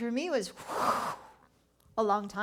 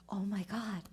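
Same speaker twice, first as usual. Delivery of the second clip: whisper; 6 dB quieter than usual. Retold or imitated. Alone in room, realization.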